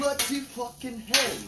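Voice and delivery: high, slow mock British outburst; Gordon Ramsay impression. Cook in his kitchen mocking a furious British chef accent. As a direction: ham up a Ramsay-style British rant. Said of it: "I wanna talk like Gordon Ramsay a bit".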